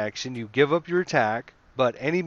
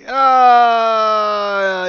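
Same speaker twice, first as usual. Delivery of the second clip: long, high, loud scream; hammed-up drawn-out dying wail; burning death scream; 9 semitones higher, 10 dB louder than usual. Game master voices a kobold burned alive, mock death scream.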